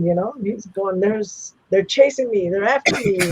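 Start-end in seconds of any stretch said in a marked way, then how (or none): 1.0-3.2 higher, louder, breathless retelling of panic; lightly acted frightened young man; panicked. Brother bursts in fleeing from imagined pursuers, mock panicked.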